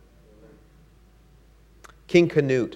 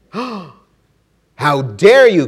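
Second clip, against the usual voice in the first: very slow, louder, higher outrage; outraged shout; 5 semitones higher, 9 dB louder, much slower than usual. Acted. Canute shouting at the wave, slow and outraged. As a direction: stretch it out in royal fury. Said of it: "Canute shouted".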